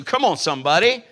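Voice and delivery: loud, rising preacher's cry; full-force preaching. Acted. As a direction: preach it hard to the congregation. Preaching healing scripture at full force.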